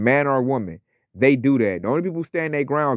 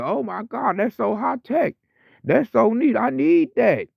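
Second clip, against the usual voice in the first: much higher, gushing, starstruck mock voice; mock amazed buyer; 11 semitones higher, slower than usual. Mocking people dazzled by the microchip, much higher gushing voice. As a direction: squeal it like a gadget-dazzled fool being fooled. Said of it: "they gonna look at that like a miracle".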